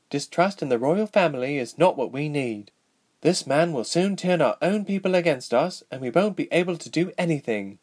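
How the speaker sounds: lightly acted, anxious princess voice; young princess, worried. A narrator's reading.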